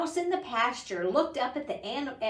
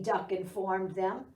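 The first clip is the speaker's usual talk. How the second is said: ordinary delivery, nothing marked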